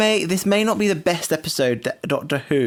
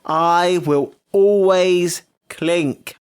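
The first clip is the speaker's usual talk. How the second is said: very slow, raised, word by word; defiant declaration; 4 semitones higher, 4 dB louder, much slower than usual. Real, not acted. Insisting after being told it's common, drawn-out defiance. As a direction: proclaim each word separately and stubbornly.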